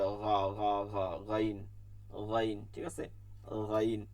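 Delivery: low, rubbed ghain in the throat; teacher rubbing out গইন; ghain demo. Shown as a demonstration. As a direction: grind the গইন at the back. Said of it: "ঘষতে হবে".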